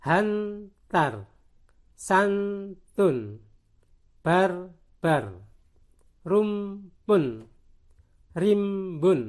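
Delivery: firm syllables with a beat between; teacher's decoding demo; syllable-by-syllable reading. Shown as a demonstration. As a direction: sound out each word in two separated halves. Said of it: "san diikuti tan dibaca santan".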